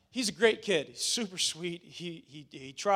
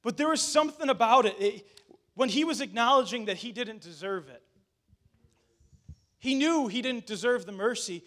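ordinary talk

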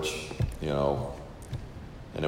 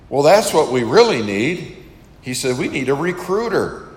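higher and louder, suddenly eager; excited pastor; 7 semitones higher, 5 dB louder than usual. Retold or imitated. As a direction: burst out like he just found the answer. Pastor suddenly enthused at the table.

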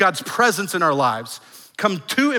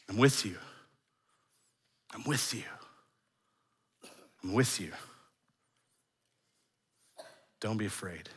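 tender preaching; 6 semitones lower, 13 dB quieter than usual. Acted. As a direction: lay the promise gently on the room. God's promise spoken tenderly.

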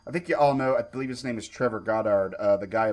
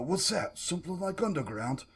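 higher-pitched limey British imitation; limey British accent; 7 semitones higher, 6 dB quieter than usual. Showing how the actor's fake Australian sounds, limey British accent. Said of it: "it's still kinda limey British".

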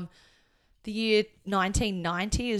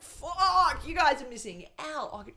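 retold exclamation; 7 semitones higher, slower than usual. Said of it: "I was like".

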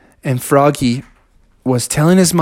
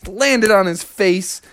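usual speaking voice

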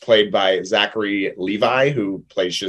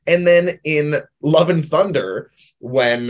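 ordinary delivery

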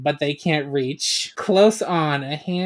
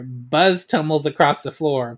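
usual speaking voice

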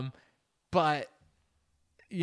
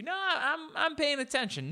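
high mock denial; 12 semitones higher than usual. Mocking passengers ignoring safety talk.

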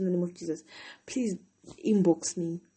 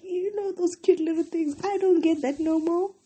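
high mock voice; 11 semitones higher, 4 dB louder than usual. Mimicking cute high voice.

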